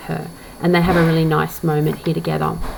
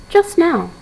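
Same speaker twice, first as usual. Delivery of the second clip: put-on a light Italian accent, lifted higher; 9 semitones higher than usual. A narrator's reading. Rezia echoes to Septimus in an Italian accent. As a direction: say it as an Italian wife would, a touch higher. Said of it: "She said that with her Italian accent".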